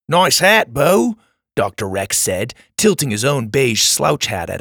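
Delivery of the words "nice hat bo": breezy Australian compliment; Australian accent. Acted. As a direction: tease lightly in an Aussie accent. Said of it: "in an Australian accent".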